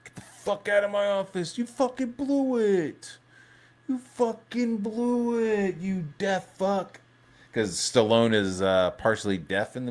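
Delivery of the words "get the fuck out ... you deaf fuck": De Niro impression. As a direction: throw him out like a furious cop. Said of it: "De Niro was just like".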